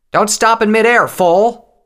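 high, loud scolding yell; exasperated commander's holler; Tailwind shouting. A narrator's reading. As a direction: shout urgently at a careless rookie. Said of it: "Tailwind shouted".

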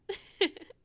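high, loud giggle; disbelieving laugh. Acted.

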